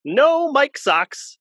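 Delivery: high, slow, stretched-out cry; drawn-out high cartoon call; sprite voice. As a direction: proclaim it like a squeaky sprite. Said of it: "could you be like".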